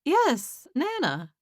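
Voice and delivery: a touch of surprise, settling down; surprised confirmation. Acted. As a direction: confirm the name with a small surprise, falling on Nana. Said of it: "looking surprised".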